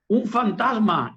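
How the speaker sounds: mock frightened cry. A narrator's reading. Preacher reads the disciples seeing a ghost, mock frightened cry. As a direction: cry out slowly as if afraid, not full volume. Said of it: "y gritaban".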